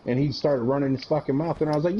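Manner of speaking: ordinary talk, nothing marked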